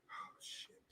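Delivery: whispered aside. Real, not acted. Laughing aside.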